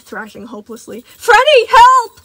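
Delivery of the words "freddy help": shrieking for rescue; desperate call for help. Acted. Gregory screaming to Freddy for help. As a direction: scream his name across the room.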